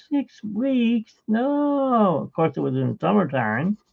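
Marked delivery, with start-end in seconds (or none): 0.1-2.2 high-pitched cry of disbelief; shocked disbelief. Retold or imitated. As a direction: recoil at the news, much higher. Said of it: "I'm like".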